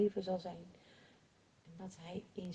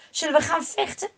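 eager question; 7 semitones higher, 6 dB louder, faster than usual. Acted. Soldier eager to fight.

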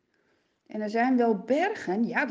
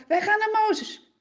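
higher, louder and fast angry demand; men telling wives they'll confront Moses, angry; 9 semitones higher, 7 dB louder, faster than usual. Acted. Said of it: "Ze staan op en ze zeggen".

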